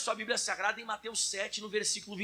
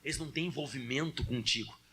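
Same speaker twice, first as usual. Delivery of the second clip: Jesus praying; 6 semitones lower than usual. Acted. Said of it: "E ele disse".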